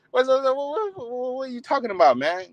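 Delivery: high, laughing disbelief; what are you talking about, laughing; incredulous. Real, not acted. Preacher scoffing at a man calling himself a square, in disbelief.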